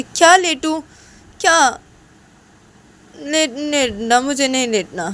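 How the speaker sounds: loud, high indignant refusal; irritated yelling. Acted. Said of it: "इतना चिल्लाओ ना मत". (चिढ़कर ऊँची आवाज़ में)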